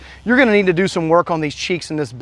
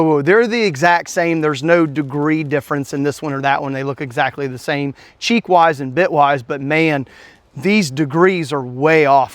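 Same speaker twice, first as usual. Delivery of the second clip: ordinary talk, nothing marked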